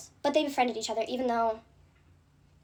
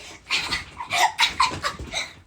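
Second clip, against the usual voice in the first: mocking cackle. Imitating the two people laughing at her, exaggerated cackle. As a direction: act out their over-the-top cackle. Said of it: "when I tell you laughing like this".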